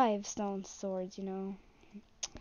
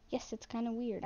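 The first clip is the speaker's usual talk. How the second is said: ordinary delivery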